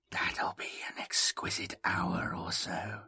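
low, sinister whisper; sinister echo. A narrator's reading. Echo relishes cutting away Jomi's body, sinister whisper. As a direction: whisper it, savouring the cruelty. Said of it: "soft, sinister echo".

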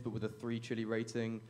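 ordinary delivery

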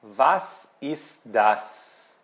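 very slow, each word apart; deliberate teacher's question with gaps between words; model question. Shown as a demonstration. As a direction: ask "Was ist das?" word by word. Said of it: "eu pergunto".

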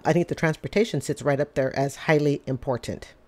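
normal talking voice

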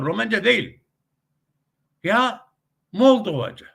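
high, emphatic imitation; dayatan Rus ağzından. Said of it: "hala daha bunu söylüyorlar". (yüksek perdeden, vurgulu taklit)

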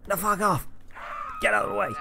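high, loud shout to clear the way; shrieking get-out-of-my-way yell; angry yell. Pretend. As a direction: bellow at them to move. Goat shoving people aside.

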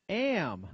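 "Am" standing alone as an emphatic word; deliberate weight on the single word "Am"; stress on AM. Shown as a demonstration. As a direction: set "Am" apart and lean on it. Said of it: "put emphasis on each word".

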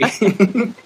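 a short, high, delighted laugh; burst of laughter. Real, not acted. Laughing at friend's teary reply.

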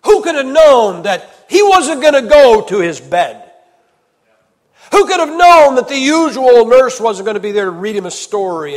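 loud, high, impassioned pulpit cry; building to a soaring preacher's shout; fiery preaching. Acted. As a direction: thunder it to the congregation. Preaching at full force.

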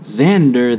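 ordinary delivery, nothing marked

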